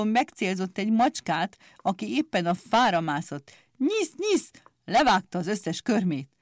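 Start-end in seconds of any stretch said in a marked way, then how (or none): 3.8-4.5 a very high, gleeful snip-snip; snipping clippers. A narrator's reading. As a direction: squeak the cutting sound.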